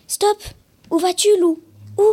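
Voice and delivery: high, loud sharp call; calling stop. Acted. Principal calls after Lou.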